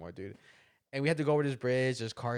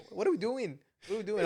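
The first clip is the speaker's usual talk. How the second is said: mock whiny complaint; 10 semitones higher, 3 dB louder than usual. Sister complaining, retold.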